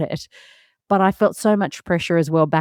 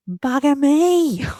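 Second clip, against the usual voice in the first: higher, drawn-out, delighted "Bugger me."; thrilled exclamation; 8 semitones higher, much slower than usual. Real, not acted. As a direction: savour the win, stretching "Bugger me." up high. Joyful exclamation, high and slow.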